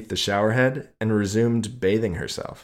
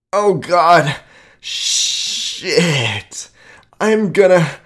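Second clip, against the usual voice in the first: high, loud, strained outcry; climax cry; 10 semitones higher, 10 dB louder, much slower than usual. Acted. Narrator crying out at his climax, high and loud. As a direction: let it break out, losing control.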